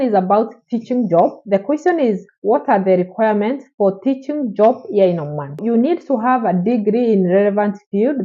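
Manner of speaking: ordinary talk, nothing marked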